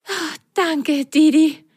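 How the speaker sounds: high voice, relieved, sighing thanks. Acted. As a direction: breathe out in relief. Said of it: "seufzte Pitsch erleichtert". (erleichtert seufzend)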